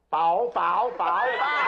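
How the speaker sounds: squawked clucking wordplay; chicken impression. Comedian squawking out a chicken joke, chicken impression. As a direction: cluck the word like a hen.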